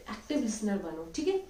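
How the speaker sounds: ordinary talk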